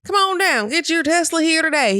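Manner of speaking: high, loud cheesy car-ad pitch; hammed-up salesman Trump. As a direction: shout it like a used car dealer. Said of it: "He didn't say that".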